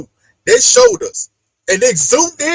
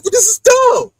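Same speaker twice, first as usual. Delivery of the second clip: higher, louder mock outburst; frustrated shout; 11 semitones higher, 4 dB louder than usual. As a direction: snap it like a petulant child. Voicing the frustrated boy.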